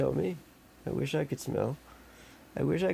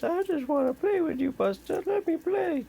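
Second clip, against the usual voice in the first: high pleading kitty voice; voicing Maggie. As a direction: beg in a high little cat voice. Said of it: "Maggie says".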